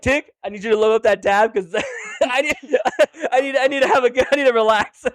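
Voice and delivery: giggling mid-sentence, barely getting words out; high laughing delivery; laughing through it. Retold or imitated. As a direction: laugh your way through the request. Laughing while quoting own request.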